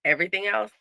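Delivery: ordinary talk, nothing marked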